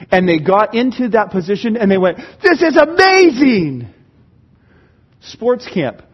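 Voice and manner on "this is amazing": high, loud, slow exclamation of delight; thrilled exclaim. Retold or imitated. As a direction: gush like a delighted volunteer. Volunteer retold delighted.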